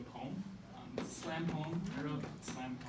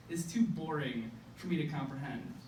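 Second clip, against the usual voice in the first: usual speaking voice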